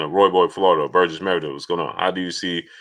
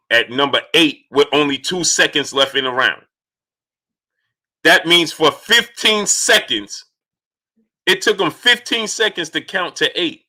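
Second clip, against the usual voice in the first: heated rant; 5 semitones higher than usual. Real, not acted. Heated rant about the count.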